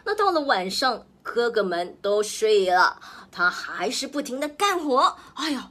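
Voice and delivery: ordinary delivery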